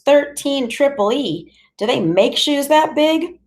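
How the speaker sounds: high, slow, mocking disbelief; smirking salesgirl. Shoe store girl to a big boy, smirking mockery. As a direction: tease the big size with a smirk. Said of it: "she's got a little smirk and she goes".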